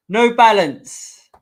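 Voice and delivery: drawn-out announcement. Pretend. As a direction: stretch the brand name out big and loud. Drawn out sponsor name.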